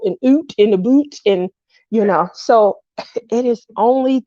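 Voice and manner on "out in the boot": jokey Canadian "oot in the boot"; a quick imitation of a Canadian accent; mock Canadian accent. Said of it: "hollering about".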